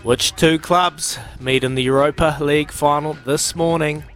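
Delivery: ordinary talk, nothing marked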